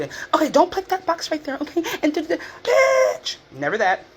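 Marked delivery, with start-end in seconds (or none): 0.3-2.4 much higher, mock talking-down-to voice; condescending mimicry. Coworkers over-explaining to her, high condescending mimicry. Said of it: "reinforcing their instructions to me like I'm stupid". 2.6-3.4 indignant outburst. Real, not acted.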